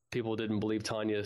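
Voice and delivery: ordinary talk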